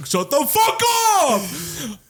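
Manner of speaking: high, drawn-out mock yell, laughing; mock group retort. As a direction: play the whole class snapping back. Acting out the annoyed classmates.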